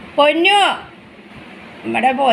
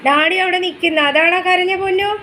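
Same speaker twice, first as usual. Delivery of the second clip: higher, louder sing-song to a little one; 6 semitones higher, 5 dB louder than usual. Real, not acted. Mother pointing out daddy to her crying baby, sing-song baby talk.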